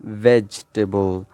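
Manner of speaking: "vegetable" shortened to VEJ-tuh-bul; "vegetable" with no vowel after the j sound; correct pronunciation. Shown as a demonstration. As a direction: repeat "vegetable" slowly in three syllables. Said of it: "it's vegetable".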